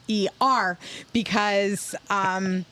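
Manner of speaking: ordinary delivery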